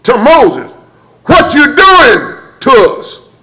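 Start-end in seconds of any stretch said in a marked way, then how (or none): 1.2-3.3 high, slow accusing people; complaining crowd. Retold or imitated. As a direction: drag out the grievance at Moses. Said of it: "the people said to Moses".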